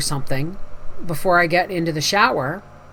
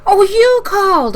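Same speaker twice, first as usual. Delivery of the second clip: high, louder retold surprise; sudden realization; 12 semitones higher, 6 dB louder than usual. Homeowner recognising the caller at the door, high surprised realization. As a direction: pitch leaps up as it dawns on you.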